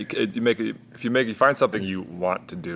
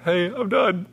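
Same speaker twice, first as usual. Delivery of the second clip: high, choked-up retelling of crying; imitating his own tearful phone call; teary voice; 11 semitones higher, slower than usual. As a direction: voice cracking, barely holding it together.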